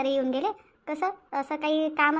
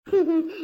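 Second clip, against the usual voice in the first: brief, higher-pitched chuckle; a quick amused laugh; short laugh; 3 semitones higher, 4 dB louder than usual. Acted. Daughter reacts to mother's talk of housework, short laugh. Laugh before teasing remark.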